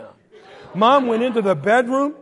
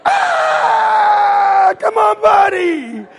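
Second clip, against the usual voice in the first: high, loud drawn-out scream; mock panic; 12 semitones higher, 8 dB louder, much slower than usual. Kid urging his body to move, high panicked scream. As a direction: yell in comic alarm, stretched out. Brother about to land on him.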